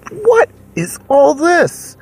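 high, drawn-out mock shock; hammed-up astonishment. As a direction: stretch it out in high-pitched disbelief. Finds a party's mess in the keep.

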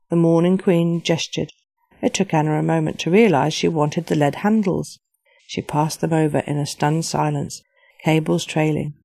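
usual speaking voice

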